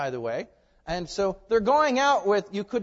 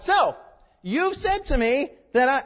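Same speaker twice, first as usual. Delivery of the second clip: mocking tone; 9 semitones higher, 7 dB louder than usual. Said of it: "Please don't take my tone. That's just because I'm a smart aleck".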